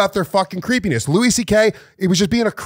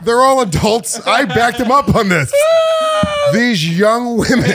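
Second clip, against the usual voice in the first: higher, louder, laughing through it; laughing shout; 7 semitones higher, 3 dB louder, slower than usual. Real, not acted. Cracking up while defending his joke to the co-host, loud laughing shout.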